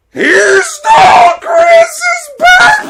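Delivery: slow, very high screamed tantrum; shrieking little girl. Retold or imitated. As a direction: shriek each word like a child losing it. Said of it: "The girl next to him".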